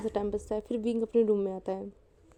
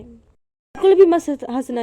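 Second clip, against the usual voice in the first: scolding shout; 3 semitones higher, 11 dB louder, slower than usual. Real, not acted. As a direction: shout her down to stop laughing. Sister laughing during story. (हंसती बहन को ज़ोर से डाँटना)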